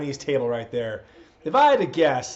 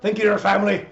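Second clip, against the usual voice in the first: loud, raised Scottish wrestler promo; Drew McIntyre; 4 semitones higher, 5 dB louder than usual. Acted. Wrestler challenging his rival for WrestleMania, Drew McIntyre impression. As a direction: bellow a challenge in a Scottish accent. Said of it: "I do a pretty good Drew McIntyre".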